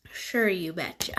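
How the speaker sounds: whispered mock North Dakota "Sure, you betcha"; hushed mock Upper Midwest "you betcha"; North Dakota accent. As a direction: drop into a playful Minnesota-style twang, whispered. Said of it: "That's more my lingo".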